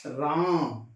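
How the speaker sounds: राम with the आ held very long; drawn-out vowel. Shown as a demonstration. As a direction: stretch the आ in राम far out. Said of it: "कितना लंबा गया राम".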